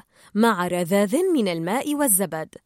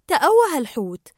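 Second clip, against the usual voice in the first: plain reading voice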